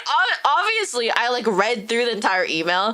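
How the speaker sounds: normal talking voice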